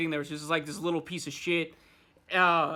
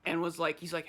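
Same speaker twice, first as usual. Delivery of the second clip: normal talking voice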